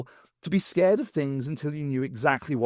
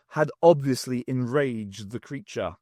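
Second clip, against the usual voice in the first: ordinary delivery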